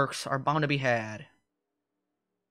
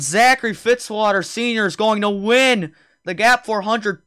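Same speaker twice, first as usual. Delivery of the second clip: high, loud race commentary; live play-by-play; 7 semitones higher, 11 dB louder than usual. Real, not acted. Announcer calls three-lap shootout to viewers, excited play-by-play. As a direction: call the restart with driving urgency.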